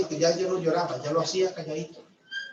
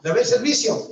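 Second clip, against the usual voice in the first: announcing it higher and louder, eager for praise; proud announcement; 7 semitones higher, 8 dB louder than usual. Retold or imitated. Husband proudly tells wife he washed the dishes, eager. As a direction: beam as you tell her the dishes are done. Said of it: "Le dice".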